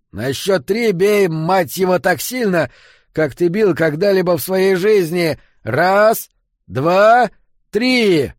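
high, loud roared orders and count; growled command. A narrator's reading. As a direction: roar the count, building to the last number. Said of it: "зарычал Эммет". (яростный командный рык)

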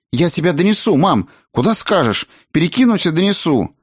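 put-on high, excited boy's voice; eager son. A narrator's reading. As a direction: offer eagerly to mum, pitched high. Сын рвётся помочь маме. (восторженный высокий мальчишеский голос)

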